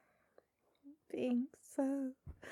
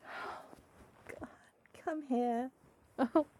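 normal talking voice

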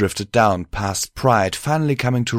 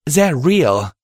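higher, louder mare, angry; angry retort; 7 semitones higher, 5 dB louder than usual. Acted. Mare defending her research to the host, angry. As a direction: insist hotly in a raised pitch. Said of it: "Pride replied angrily".

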